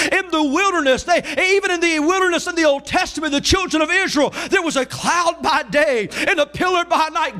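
driving, high-pitched sermon delivery; Southern revival preacher in full flow; full-force preaching. Real, not acted. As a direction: preach it with rising intensity.

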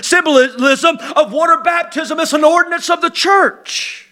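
preaching with mounting force; rising preaching. Acted. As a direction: build the story to a loud climax. Preaching the chief's story.

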